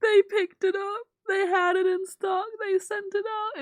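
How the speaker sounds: high, loud happy relief; excited relief. Real, not acted. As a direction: rattle off the good news. Relief after shipping news.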